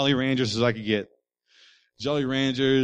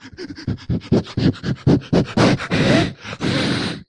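high, wheezing breathless laugh; 8 dB louder than usual. Retold or imitated. Preacher retelling crying as a boy, laughing breathlessly. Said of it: "that cry when you can't breathe".

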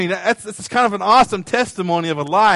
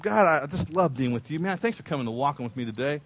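Enoch speaking to God; 4 semitones lower, 9 dB quieter than usual. Acted. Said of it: "I'll be Enoch and you can be God".

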